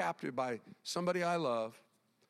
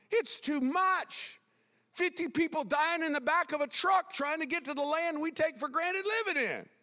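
high, strained-up pulpit cry; impassioned preaching; 11 semitones higher, 4 dB louder than usual. Real, not acted. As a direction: cry out the injustice to the congregation. Preaches at full force.

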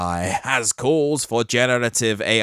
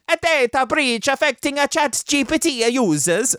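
high, hammed-up Italian accent; broad mock Italian, pitched way up; 12 semitones higher than usual. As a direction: read it as a cartoon Italian. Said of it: "Italian accent indoors with the kids".

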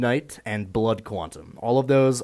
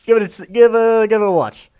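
upbeat recommending; 12 dB louder, faster than usual. Retold or imitated. Retold own casual recommendation.

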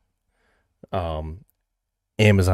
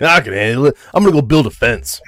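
macho bravado; 5 semitones higher, 8 dB louder than usual. Retold or imitated. Hamming the macho quote.

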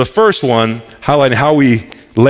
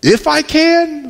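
high, slow, amused disbelief on "If I can?"; incredulous Jesus; 12 semitones higher, slower than usual. Retold or imitated. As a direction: throw "If I can?" back high and slow, scoffing. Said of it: "Jesus' response is like".

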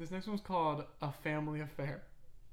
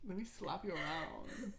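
mock dominant; 4 semitones higher, 5 dB quieter than usual. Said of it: "Could you imagine".

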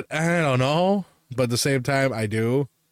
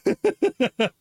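high laugh; 12 semitones higher than usual. Real, not acted. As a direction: burst of high giggles. Laughs at own slip.

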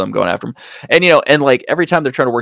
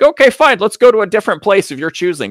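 mock exasperated giving-in; 9 semitones higher than usual. Suspect agreeing to drive the detective elsewhere, mock exasperated.

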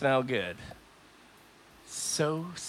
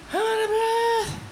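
much higher, strained and winded; squeaky breathless voice. Real, not acted. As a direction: squeak it out as if gasping.

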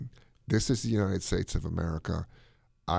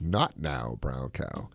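"Not now, brown cow" sunk low and soft; "Not now, brown cow" falling away, dismissive; low and dropping; 8 semitones lower, 3 dB quieter, slower than usual. Shown as a demonstration. Host's vegan twist on the drill, low and dismissive.

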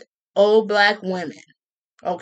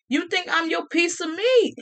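ordinary talk

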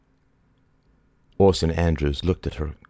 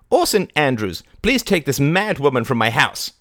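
put-on high, loud commanding old woman; imperious old lady; 9 semitones higher, 4 dB louder than usual. A narrator's reading. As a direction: order the bailiff about. Lady Barbara voiced.

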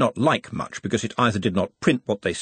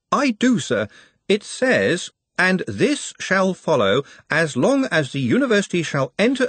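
lightly voiced careful reader, higher; a hint of a precise clerk; Ponder reading; 9 semitones higher than usual. A narrator's reading.

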